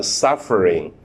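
ordinary talk, nothing marked

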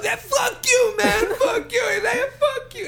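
very high, squealed parting yell; mock shouting. Driver yelling back as he leaves the lot, high mock shout. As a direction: imitate a receding angry yell, comically high.

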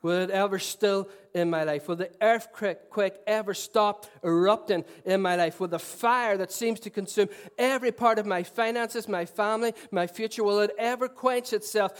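loud, ringing preacher's cry; impassioned sermon climax; preaching at full force. Acted. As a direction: lift the room with each question.

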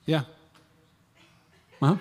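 sulky yeah. Retold or imitated. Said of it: "And this is me".

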